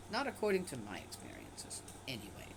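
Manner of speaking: ordinary delivery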